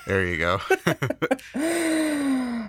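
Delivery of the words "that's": high squealing laugh. Real, not acted. Laughing at Mickey impression.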